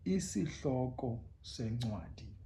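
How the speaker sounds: Isihloko sencwadi said slowly with the hl and c sounds; isiXhosa phrase. Shown as a demonstration. Teacher modelling Isihloko sencwadi slowly. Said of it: "you would say".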